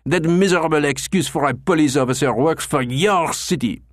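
raging. Acted. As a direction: rage at the injustice done to him. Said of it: "Clochard was raging".

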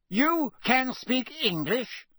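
high, slow, incredulous outburst; Fudge outraged. A narrator's reading. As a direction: demand it in affronted disbelief. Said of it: "sounding outraged".

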